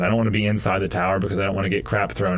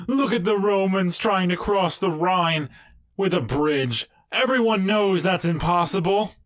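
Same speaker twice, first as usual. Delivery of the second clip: higher, scoffing voice; mocking tribesman; 9 semitones higher than usual. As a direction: sneer at the Romans. Mocking onlookers in skit.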